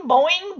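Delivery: springy cartoon boing, mouthed; imitated boing. Retelling the show's effect, a vocal boing. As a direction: bounce it like a rubber nose. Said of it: "you hear the boing".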